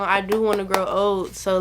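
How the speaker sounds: ordinary talk, nothing marked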